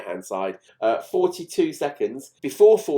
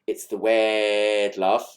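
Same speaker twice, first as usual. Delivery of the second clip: light Scouse imitation; slower than usual. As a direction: croon it slowly in a mild Scouse accent. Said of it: "[name]'s Scouse accent when he does".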